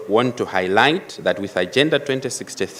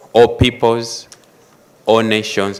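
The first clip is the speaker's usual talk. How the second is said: "all" hit louder at the head of each phrase; stress on ALL; 2 semitones higher, 5 dB louder than usual. Real, not acted. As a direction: hammer each "all" in the list. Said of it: "which emphasizes the aspect of all".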